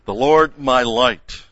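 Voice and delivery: "Lord" and "light" punched high and loud; forceful, lifted stress on Lord and light; very strong. Shown as a demonstration. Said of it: "makes it very strong in the Hebrew".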